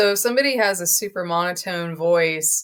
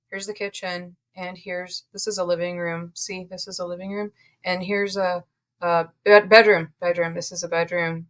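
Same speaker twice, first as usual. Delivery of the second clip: monotone agent. Retold or imitated. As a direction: drone through the rooms like you don't care. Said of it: "the agent says".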